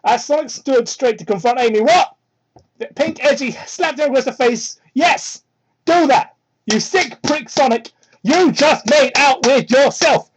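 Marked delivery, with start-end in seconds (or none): 1.8-2.1 high, loud incredulous what; disbelief. Real, not acted. Reader stunned by the fan fiction, incredulous. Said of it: "reacting in disbelief". 4.9-10.3 cheering. Real, not acted. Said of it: "I'm getting loud".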